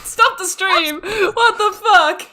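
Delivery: high, tearful laughing protest; overwhelmed, weepy disbelief; actually crying. Real, not acted. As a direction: wail it through real tears and laughter. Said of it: "I'm actually crying".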